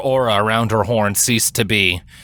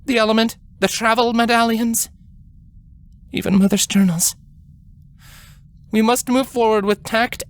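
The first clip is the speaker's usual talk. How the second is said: a higher, measured voice of a grave royal sister; grave elder queen; 12 semitones higher than usual. A narrator's reading. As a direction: speak as the older sister calming her gently but firmly. Celestia explains stolen treasures.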